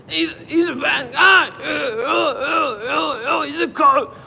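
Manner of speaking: high, loud stammering accusation; mock shout. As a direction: shout it like a frantic heckler. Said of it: "mocking commenters".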